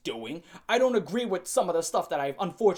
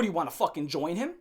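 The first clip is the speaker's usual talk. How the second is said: ordinary talk, nothing marked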